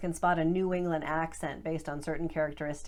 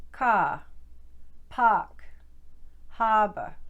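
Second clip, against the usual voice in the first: Boston drop the R in "ka, pak, harbor", long open ah vowel, louder; Boston accent; 3 semitones higher, 4 dB louder, much slower than usual. Shown as a demonstration. Coach shows students Boston accent, dropped R and long ah. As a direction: coach demonstrates Boston R-less words, held ah vowel. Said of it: "they're gonna sound like".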